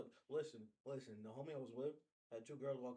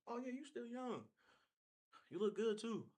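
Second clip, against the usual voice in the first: high playful compliment; 10 semitones higher, 4 dB louder than usual. Retold or imitated. Voicing herself flattering him.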